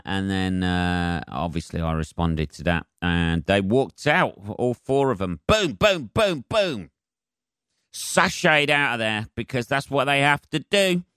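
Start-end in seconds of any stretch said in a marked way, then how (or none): 5.5-6.9 high booming footsteps sung out; playful imitated stomping noise; mock stomping booms. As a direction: boom out each stomp like marching feet. Mimicking women stomping out.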